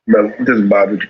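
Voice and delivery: normal talking voice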